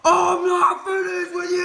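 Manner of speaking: loud, high roar of a wrestler's catchphrase; hammed-up wrestler taunt; wrestler catchphrase shout. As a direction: yell it like the big man in the ring.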